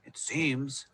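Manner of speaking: soft, to himself; thinking aloud. A narrator's reading. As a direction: muse quietly. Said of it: "he thought".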